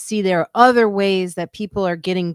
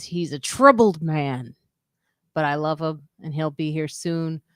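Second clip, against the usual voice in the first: usual speaking voice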